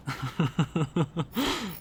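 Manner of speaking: long rapid chuckle; real chuckle. Real, not acted. Gamer reacting to a useless suggestion, chuckling. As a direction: chuckle steadily in quick bursts. Laughing at none-of-our-lines result.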